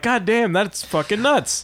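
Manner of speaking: high-pitched acted astonishment; high, wowed exclamation; amazed viewer. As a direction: play someone blown away by what they watch.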